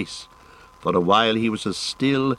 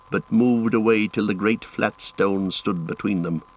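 ordinary delivery, nothing marked

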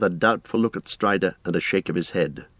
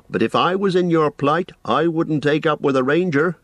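higher-pitched, disapproving landlord; suspicious innkeeper; 9 semitones higher, 4 dB louder than usual. Acted. Innkeeper warns guest about the ranger, suspicious. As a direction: caution a guest against bad company.

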